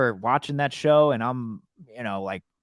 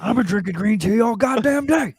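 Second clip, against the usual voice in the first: much higher and louder hammed-up rant; Danny McBride quote; 10 semitones higher, 5 dB louder than usual. Quoting a McBride movie line to his friend, higher and louder. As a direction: blurt it out like an unhinged movie character.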